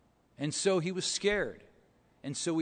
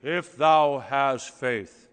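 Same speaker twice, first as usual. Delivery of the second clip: slow, lower, louder voice from heaven; booming voice of God; 4 semitones lower, 5 dB louder, much slower than usual. Retold or imitated. God answering the man on the branch, slow booming voice. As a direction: boom it down like God from the sky. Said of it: "this voice booms from heaven".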